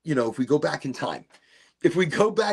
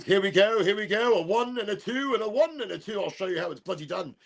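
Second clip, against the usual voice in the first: higher, bouncing boxer's countdown; mock fighter bravado; 6 semitones higher than usual. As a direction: hype yourself up for a brawl, then speed into the boast. Dad acting out a fight.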